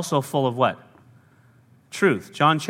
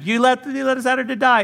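complaining Israelites; 10 semitones higher than usual. Acted. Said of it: "they're complaining again".

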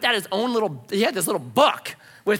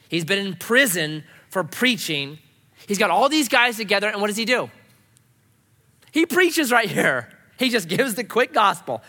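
usual speaking voice

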